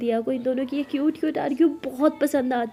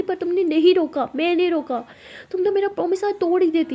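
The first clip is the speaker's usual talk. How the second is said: louder, higher, accusing; angry accusation; 6 semitones higher, 6 dB louder than usual. Acted. Lakshmi accusing Lily of nearly breaking the promise, angry raised voice. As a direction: hammer her with the accusation.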